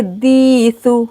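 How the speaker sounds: दीसु with a long held dee, short su; lengthened dee in दीसु; stretched dee. Shown as a demonstration. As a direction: draw out the first vowel of दीसु. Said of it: "दाल को खींचेंगे".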